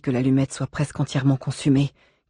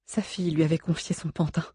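usual speaking voice

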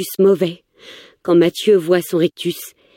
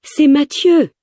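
much higher, desperate exclamation; alarmed cry; 10 semitones higher, 3 dB louder, slower than usual. A narrator's reading. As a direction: cry it out in high-pitched alarm. Narrator pleads as gun is aimed. (cri aigu et alarmé)

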